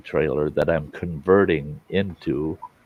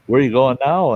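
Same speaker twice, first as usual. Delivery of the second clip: neighbor's yell, louder and much higher; yelling question; 9 semitones higher, 7 dB louder than usual. Retold or imitated. Neighbor calling to him as he pulls out, yelling. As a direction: call it out, raised and high-pitched. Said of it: "he yells at me".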